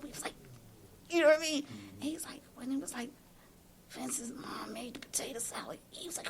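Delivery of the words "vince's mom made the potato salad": retold whisper. Quietly telling husband the secret.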